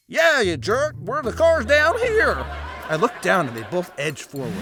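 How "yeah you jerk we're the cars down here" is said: high, loud Southern wise guy; Studebaker car's voice. Acted. Studebaker tells the man where to look, jeering high voice. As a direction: heckle him in a high put-on voice. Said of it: "And then the Studebaker says".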